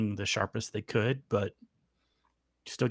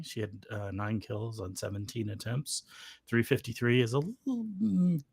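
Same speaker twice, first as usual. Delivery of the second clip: ordinary talk, nothing marked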